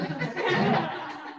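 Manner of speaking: a quick high real laugh; brief amused laugh; short laugh. Real, not acted. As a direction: laugh lightly at the coincidence. Laughs after naming the ustaz.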